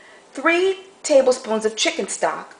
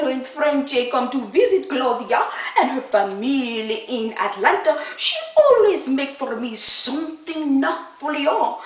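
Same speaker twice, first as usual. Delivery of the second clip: put-on Frenchie French accent. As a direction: overplay a comic French visitor. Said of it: "isn't that Frenchie a character".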